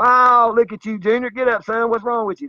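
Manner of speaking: high, teasing parent voice; jolly a fallen child back up; hammed-up singsong chiding, loud first word; playful parent. Imagined parent making light of a fall.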